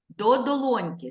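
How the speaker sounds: ordinary delivery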